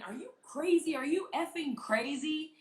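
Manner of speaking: high, outraged mock yell; retold scream. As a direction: imitate yelling at a driver, pitch way up. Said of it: "So I start screaming".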